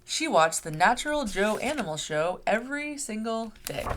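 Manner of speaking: plain reading voice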